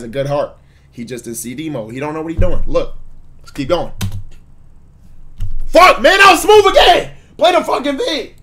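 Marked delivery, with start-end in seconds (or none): 5.7-8.3 much higher and louder squawk; mock frustrated yelp. Real, not acted. Streamer flubbing his words and cueing the beat, high squawk. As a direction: squeal it out in comic frustration.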